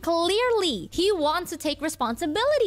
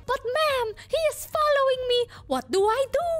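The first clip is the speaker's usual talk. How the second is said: high, pleading mock panic; re-enacted flustered panic in a high voice; panicked protest; 11 semitones higher than usual. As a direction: plead helplessly, pitched way up. Acting own panic while followed.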